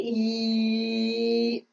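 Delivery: "E" held as one long, level "eee"; a drawn-out, even "eee" for "E"; long-held vowel glide. Shown as a demonstration. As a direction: stretch "E" out like a sustained vowel. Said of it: "pronounce it for any length of time".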